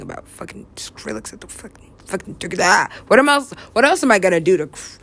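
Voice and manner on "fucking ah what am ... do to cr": ordinary talk, nothing marked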